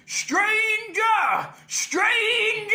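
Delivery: distorted rock shout. Shown as a demonstration. Coach demonstrating [name]'s tone, shouted high. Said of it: "really sort of emphasize it".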